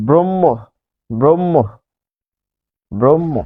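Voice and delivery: ব্রহ্ম said "brom-mo"; doubled m. Shown as a demonstration. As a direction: round vowel and long m in ব্রহ্ম, h silent. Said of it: "যেহেতু দুইবার উচ্চারিত হচ্ছে".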